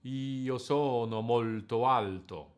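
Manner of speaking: "Io sono molto alto" in slow dotted rhythm, stressed syllables long, unstressed ones short and sliding on; sing-speak the line long-short on every word; sacred Italian rhythm. Shown as a demonstration. Teacher demonstrates the line in dotted eighth rhythm, slow and measured. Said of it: "we will obtain this".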